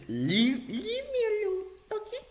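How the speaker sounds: high, whiny mock of his plea; mocking whiny quote. Mocking a friend's plea in a whiny voice. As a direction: whine it like a sulky kid. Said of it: "He says".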